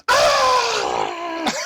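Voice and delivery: high, loud imitated scream of fright; mock scream. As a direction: scream high like the startled girl. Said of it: "the girl goes".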